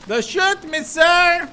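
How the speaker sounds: loud, high desperate begging; pleading in English. Pretend. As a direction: beg for your life, yelling. Begging enemy again. (súplica desesperada gritando)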